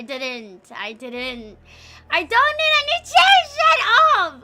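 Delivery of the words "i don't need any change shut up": high, loud embarrassed yelling; loud, high mortified protest; flustered shout. Real, not acted. Flustered at chat.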